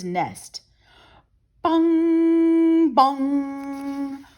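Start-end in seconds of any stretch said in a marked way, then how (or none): 1.6-4.2 loud ringing bell imitation; mock church bell ringing; bell bong. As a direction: boom it like a big church bell.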